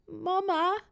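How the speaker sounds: high, thin put-on whimper; whiny tearful little voice. A narrator's reading. Boy calling for mum from bed, tearful. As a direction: call out like a child about to cry. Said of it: "roept hij met een huilerig stemmetje".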